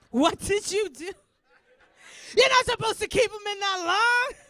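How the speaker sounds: loud, high scolding; mock accusing shout. Mother blaming daughter at toilet, mock horrified shout. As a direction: shout it in overblown blame.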